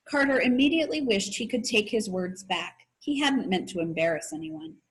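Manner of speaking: ordinary delivery, nothing marked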